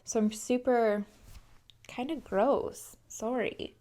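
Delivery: soft, slow, congested apology; sniffly sick voice. Real, not acted. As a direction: trail off, sounding stuffed up. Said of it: "congested and sniffly".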